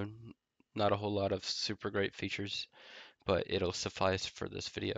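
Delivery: normal talking voice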